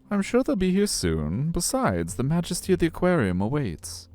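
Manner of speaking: high, sing-song customer-service voice; friendly aquarium guide. Acted. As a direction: greet kids in a high, chirpy guide voice.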